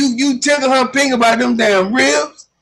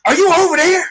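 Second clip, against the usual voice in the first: higher-pitched auntie voice; lightly voiced excited aunt; imitating auntie; 7 semitones higher than usual. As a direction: play the nosy aunt, pitched up. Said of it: "she go".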